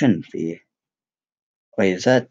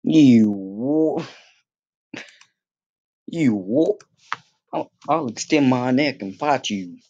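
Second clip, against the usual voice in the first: mock fighting talk; 5 semitones higher, slower than usual. Taunting opponent in game.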